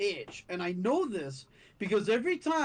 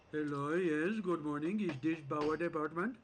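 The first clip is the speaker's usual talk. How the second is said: lower, Punjabi accent answering phone; 5 semitones lower than usual. Shown as a demonstration. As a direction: Punjabi accent, polite and formal. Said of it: "I can actually do a good Punjabi accent".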